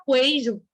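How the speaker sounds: "queijo" mispronounced as "kwei-jo"; "queijo" with its silent u wrongly voiced; wrong, u sounded. Shown as a demonstration. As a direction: put a w glide after the k in "queijo". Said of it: "Ninguém fala".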